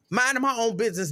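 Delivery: normal talking voice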